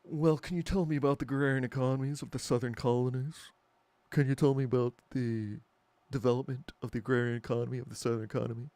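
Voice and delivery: quiet, put-on know-it-all student voice; Good Will Hunting guy. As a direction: imitate a pretentious bar-room intellectual. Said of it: "that guy in fucking Good Will Hunting".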